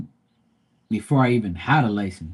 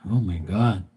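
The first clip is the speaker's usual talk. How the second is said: ordinary delivery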